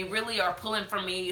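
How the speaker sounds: normal talking voice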